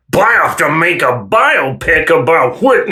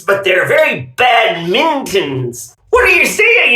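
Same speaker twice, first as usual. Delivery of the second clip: ordinary talk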